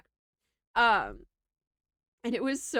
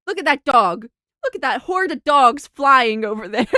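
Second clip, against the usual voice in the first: mock announcing; 8 dB louder than usual. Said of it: "we'd be like".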